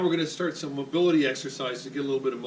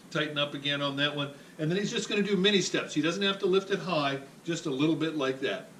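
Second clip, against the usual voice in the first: ordinary talk, nothing marked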